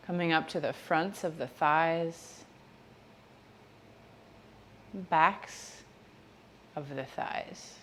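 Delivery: gentle, measured meditation voice, spacious phrasing; guided meditation. Real, not acted. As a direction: ease them through each body part, softly and unhurriedly. Leads a body-scan meditation.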